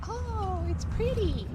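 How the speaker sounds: squeaky high mock wonder; mock dazzled. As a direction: gush like a dazzled fool. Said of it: "You're like".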